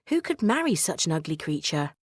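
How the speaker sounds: usual speaking voice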